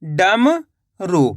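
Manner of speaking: a teacher stretching डमरू slowly for the class; drawn-out blended word. Shown as a demonstration. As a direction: stretch डमरू very slowly, each sound held. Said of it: "तो मिलके बन जाएगा अपने".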